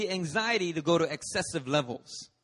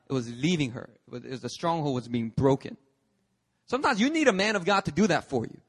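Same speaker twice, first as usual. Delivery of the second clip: ordinary talk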